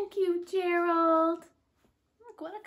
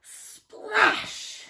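usual speaking voice

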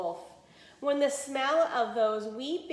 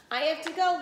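quick hurry; 7 semitones higher, 6 dB louder than usual. A narrator's reading. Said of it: "said quickly".

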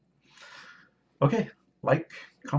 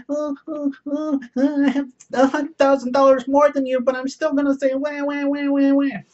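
much higher, whiny mocking voice; whiny Mario impression; 13 semitones higher than usual. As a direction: whine like a spoiled child, much higher. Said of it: "this would be Mario".

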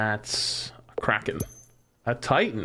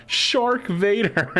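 higher, louder, slow and drawn out; excited announcement; 9 semitones higher, 5 dB louder, much slower than usual. Real, not acted. Gamer thrilled by his new combo, slow loud reveal. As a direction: savour the name like a grand reveal. Delighted by new game combination.

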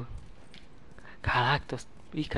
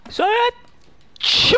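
high shouted praise for the team; excited cheer; 11 dB louder than usual. Real, not acted. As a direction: yell it out in triumph. Cheering teammates in game.

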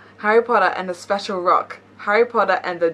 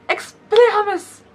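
shouted spell; 13 semitones higher, 7 dB louder than usual. Acted. Harry casting a spell.

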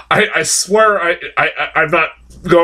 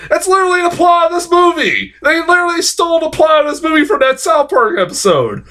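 excited shouting; 14 semitones higher than usual. Real, not acted. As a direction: yell it in outrage. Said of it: "I got all worked up".

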